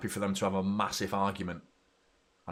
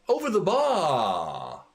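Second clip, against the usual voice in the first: higher, slow dismayed moan; dismayed repeat; 7 semitones higher, 5 dB louder, much slower than usual. Real, not acted. Fan repeating the miss in slow dismay. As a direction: let the disbelief sink slowly.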